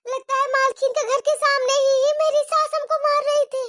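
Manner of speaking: ordinary delivery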